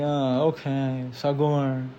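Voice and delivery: slow, soft, deflated; softly giving up as the store closes; resigned defeat. Retold or imitated. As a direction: give in quietly and sadly. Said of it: "And you're just like".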